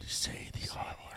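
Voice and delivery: hushed whisper. Real, not acted. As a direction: whisper it like a dare. Urging a taboo word.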